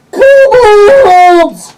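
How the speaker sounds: very loud, high drawn-out scream; gnome scream. Acted. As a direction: scream the word as a gnome's alarm. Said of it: "You hear the gnome scream".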